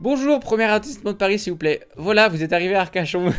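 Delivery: lightly voiced, high-pitched polite passenger and driver; acted taxi exchange. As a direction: play a cheery little ride sketch.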